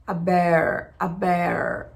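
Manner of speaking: "aber-" with the tongue curling back on the R; curled R drill. Shown as a demonstration. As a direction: drag out the R of "aber-". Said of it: "tentando falar aberto, puxando o R".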